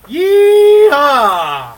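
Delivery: loud, high, drawn-out yeehaw; rebel yell. Pretend. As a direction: whoop it out long and high, then let it drop. Whooping out a Dukes catchphrase.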